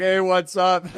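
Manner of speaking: loud, high mock hail; calling out greeting. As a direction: holler hello like a gym regular. Imitating everyone greeting him across gym.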